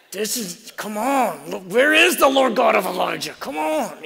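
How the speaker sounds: hammed-up call for fire from heaven; mock vengeful prayer. Preacher retelling his angry prayer to God, loud high mock plea. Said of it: "I was like".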